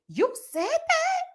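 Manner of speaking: high, slow incredulous squeal; shocked quote. Retold or imitated. Quoting Kenya's shocked reunion line. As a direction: stretch it out in disbelief. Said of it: "as she said".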